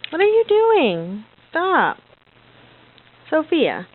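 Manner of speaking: normal talking voice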